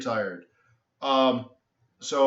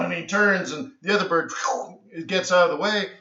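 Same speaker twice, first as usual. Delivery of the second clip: ordinary talk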